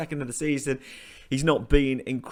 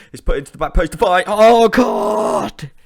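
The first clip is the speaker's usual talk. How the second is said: building into a loud, high cry of despair; fast and loud, then a howling "Oh, God!"; anguished shout; 8 semitones higher, 12 dB louder than usual. Real, not acted. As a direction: yell as the ball goes in. Last-minute chance against them.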